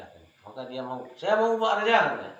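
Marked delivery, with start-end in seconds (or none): 1.2-2.0 high, loud eager shout; eager volunteer. Acted. Thug volunteering to the king, loud and eager. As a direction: jump at the offer.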